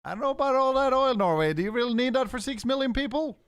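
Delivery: high, fast, sly voice of a greedy superpower; a big power teasing Norway in a mocking falsetto. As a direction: play the country eyeing our oil, smug and high. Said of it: "might at some point be like".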